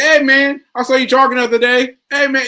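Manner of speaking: high, loud, bright friendly hello; cheery greeting. Retold or imitated. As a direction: call out like a pal spotting you. Friends greet him after the stroke.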